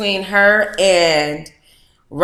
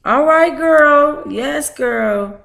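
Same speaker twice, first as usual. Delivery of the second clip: excited cheer; 9 semitones higher, much slower than usual. Real, not acted. Streamer cheering a photo of her friend, excited.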